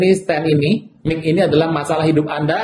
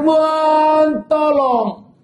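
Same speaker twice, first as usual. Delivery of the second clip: desperate cry for help; 9 semitones higher, much slower than usual. Acted. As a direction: cry out as if drowning in trouble. We cry out in trouble. (berseru minta tolong dengan suara tinggi dan keras)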